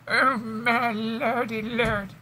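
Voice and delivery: slow, high dramatic wail; hammed-up lament. Host wails to the chat after shutting the dog out, high and slow. Exasperated while dog is let out.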